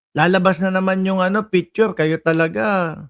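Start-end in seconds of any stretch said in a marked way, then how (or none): none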